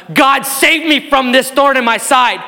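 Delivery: crying out. Retold or imitated. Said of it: "he cries out and goes".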